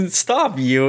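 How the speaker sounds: giggling, tipsy lady; giggly drunk. Acted. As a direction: giggle out a playful scold. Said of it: "I'm a little tipsy".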